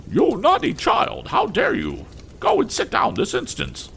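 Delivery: very high, imperious scolding lady; haughty mother scolding. A narrator's reading. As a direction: look down on the child and scold her sharply. Mrs Pocket voiced in story.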